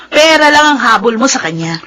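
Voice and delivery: ordinary talk, nothing marked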